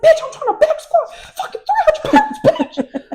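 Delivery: very high, loud mock protest; heated comeback. Student argues back at strength coach, high and worked up. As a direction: squeal back at the coach, laughter at the end. Acted shouting match with coach.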